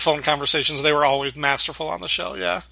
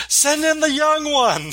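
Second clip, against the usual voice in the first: higher, slow hammed-up call from the back room; a hint of tipsy ladies shouting for the young one; mock women's shout; 10 semitones higher, slower than usual. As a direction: playfully holler it like rowdy drinkers. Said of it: "you just hear the women shout".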